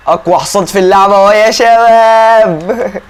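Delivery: loud, high, drawn-out reveal; excited showman's yell, stretched out slowly; triumphant shout. Real, not acted. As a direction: announce it like a grand unveiling. Shouted reveal of the strongest horse.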